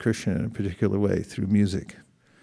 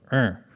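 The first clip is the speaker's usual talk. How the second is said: grunt. Shown as a demonstration. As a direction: grunt like a caveman. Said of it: "A grunt means like".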